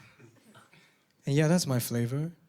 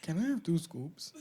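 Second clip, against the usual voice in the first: softer, mock-polite order; politely asking; 10 dB quieter than usual. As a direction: ask the clerk sweetly and quietly. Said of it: "I would have been like".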